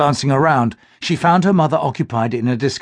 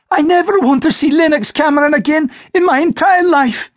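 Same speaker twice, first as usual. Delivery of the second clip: put-on much higher and louder, heartbroken young woman; distraught girl; 5 dB louder than usual. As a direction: cry out a vow in hurt. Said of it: "she had said".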